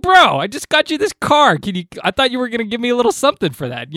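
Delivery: a loud, high, indignant bro; exasperated. Retold or imitated. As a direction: tenant scolds the ungrateful doorman in hammed-up outrage. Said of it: "It's like".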